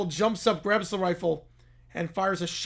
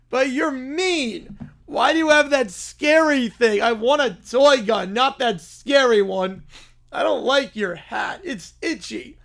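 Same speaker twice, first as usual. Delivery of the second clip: put-on whiny little kid; 5 semitones higher, 6 dB louder than usual. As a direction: whine like a tired child who wants his mommy. Whiny bear cub voice.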